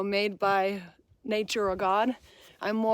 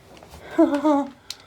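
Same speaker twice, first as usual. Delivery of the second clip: nervous laugh; 4 semitones higher, 5 dB louder than usual. Real, not acted. Scared atop wobbly tower.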